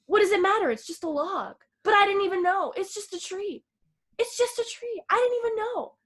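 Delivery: high, defensive excuse-making; whiny protest. Retold or imitated. Retelling a man who won't apologize for the log, high whiny protest. Acted Mark refusing to apologize.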